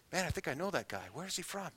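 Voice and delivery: quiet catcher wondering who the batter is; quiet puzzled musing. Retold or imitated. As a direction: puzzle over the batter under your breath. Catcher thinking to himself.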